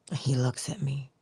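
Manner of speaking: normal talking voice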